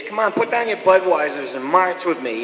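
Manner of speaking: mock screech, Boston accent; screeching Boston protester. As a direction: bark it out like a busing-era Boston mother. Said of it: "would be screeching about".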